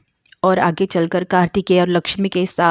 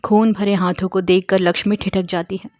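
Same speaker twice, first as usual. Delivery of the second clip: ordinary talk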